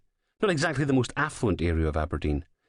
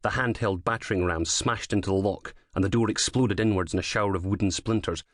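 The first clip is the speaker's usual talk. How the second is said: usual speaking voice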